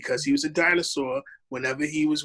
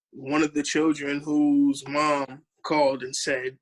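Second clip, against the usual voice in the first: ordinary talk, nothing marked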